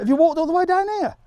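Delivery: loud, high-pitched astonishment; amazed disbelief. Real, not acted. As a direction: exclaim it in real disbelief. Amazed by passer-by's long walk.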